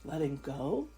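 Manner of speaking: very slow, stretched words with long pauses; calm, sustained yoga nidra voice; guided breathing meditation. Acted. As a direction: draw out each inhale and exhale cue. Leads a counted breathing meditation.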